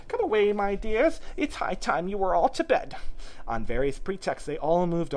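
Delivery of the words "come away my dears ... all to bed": high, loud, quavering call; anxious bird parent calling children away; trembling voice. A narrator's reading. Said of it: "called out in a trembling voice".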